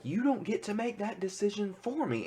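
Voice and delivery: high-pitched mock scream, held back in volume. Vision confronting Wanda over his decision, retold as mock screaming. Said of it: "he is screaming at her".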